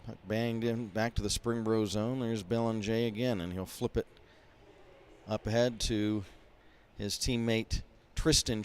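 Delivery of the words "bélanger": Belanger as bel-on-ZHAY; French ending "zhay" on Belanger; French pronunciation. Real, not acted. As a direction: say Belanger the French way, not "jer". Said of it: "in the French pronunciation".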